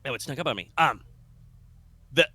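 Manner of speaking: ordinary talk, nothing marked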